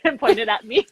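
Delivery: ordinary talk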